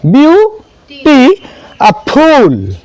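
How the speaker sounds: ब्यू, टी, फूल each said alone; three separate sounds. Shown as a demonstration. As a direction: pause after ब्यू and टी, then a long फूल. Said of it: "तीन तरीके से आवाज आ रहा है".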